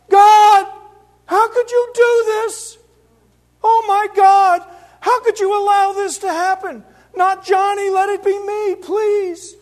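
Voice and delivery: loud, high screaming cry at God; anguished yell. Retold or imitated. Grieving brother screaming at God, loud anguished wail. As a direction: howl it up at the sky, slow and broken. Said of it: "I yelled out".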